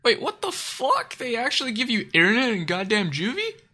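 high-pitched incredulous question; astonished. Real, not acted. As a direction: burst out in stunned disbelief. Astonished at internet in juvie.

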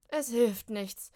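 loud, very slow, exhausted groan. Acted. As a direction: gasp it out after hauling. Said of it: "stöhnt Molly". (erschöpft stöhnend)

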